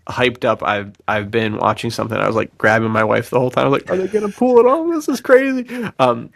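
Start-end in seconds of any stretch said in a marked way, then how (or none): 3.9-5.7 high, breathless thrill at the screen; excited disbelief. Retold or imitated. Husband grabbing his wife during a tense episode, excited high voice. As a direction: squeal it like you can't believe the show. Retelling excitement watching Better Call Saul.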